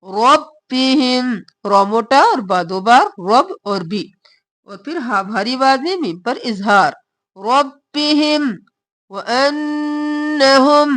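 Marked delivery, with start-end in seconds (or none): 0.0-1.3 slow recitation. Shown as a demonstration. Models the word slowly. 7.4-10.9 slow recitation. Shown as a demonstration. Models the words slowly.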